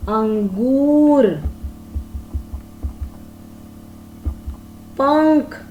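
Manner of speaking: "झंडा", "पतंग", "हंस", "बंदर", "अंगूर", "पंख" said slowly, one by one, with the nasal clearly through the nose; anusvara words demo. Shown as a demonstration. Teacher to pupils, sounding out anusvara words slowly and nasally. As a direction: draw out each word so the nasal hum of the bindu is heard. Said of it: "आइए हम कुछ ऐसे शब्दों का उच्चारण करके देखें".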